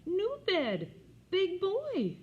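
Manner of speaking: put-on high, loud toddler voice; proud little boy. A narrator's reading. Fudge boasting about his bed, high toddler voice. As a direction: show off like a proud small child. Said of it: "Was he proud".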